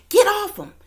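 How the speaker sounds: high, loud mock scream of get off him; woman yelling to stop a fight; imitating Jaguar screaming. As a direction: shriek it like she's pulling someone off. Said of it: "you can hear Jaguar saying".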